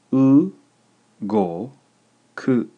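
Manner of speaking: u, go and ku split by pauses; slow dictation, high emphatic u, then go and ku apart; syllable by syllable. Shown as a demonstration. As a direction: dictate u, go, ku one at a time, u pitched high. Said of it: "is three syllables".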